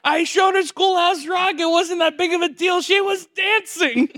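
high, loud mock shouting; frantic defensive dad. Imitating a father defending himself to his wife, frantic shout. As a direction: protest at the top of your voice, hammed-up.

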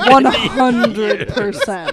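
words through laughter; laughing. Real, not acted. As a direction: laugh while agreeing. Laughing along.

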